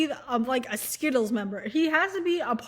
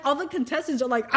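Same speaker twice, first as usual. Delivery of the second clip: ordinary talk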